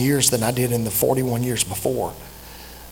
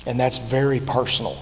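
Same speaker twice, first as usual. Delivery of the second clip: normal talking voice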